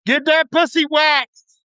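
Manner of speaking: high, slow yelled catcall; mock yell out the car. Man yelling from his car at women. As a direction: bellow it across the parking lot. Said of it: "I just wanna start yelling out my car".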